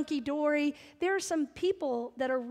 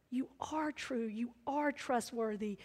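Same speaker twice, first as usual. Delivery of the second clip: soft, lowered, reverent; hushed prayer; 3 semitones lower, 8 dB quieter than usual. Retold or imitated. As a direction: breathe it quietly to God. Silent prayer to God.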